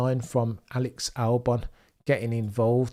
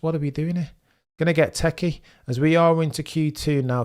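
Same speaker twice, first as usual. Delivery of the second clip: ordinary talk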